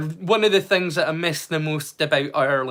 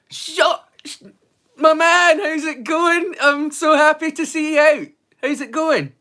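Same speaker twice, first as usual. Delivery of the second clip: much higher, calling out loud and eager; excited greeting; 9 semitones higher, 4 dB louder than usual. Real, not acted. Welcoming friend Sean into the stream, high excited call. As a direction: hail a mate you're thrilled to see.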